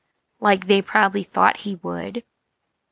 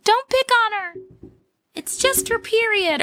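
mocking high voice; 11 semitones higher than usual. Mocking voice of imagined supporters.

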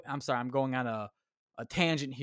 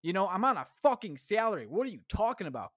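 ordinary talk

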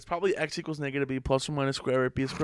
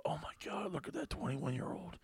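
awed hush; 9 dB quieter than usual. Retold or imitated. Retold awed seniors, very soft.

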